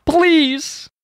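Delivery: high desperate plea; begging scream. Pretend. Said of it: "she's screaming now".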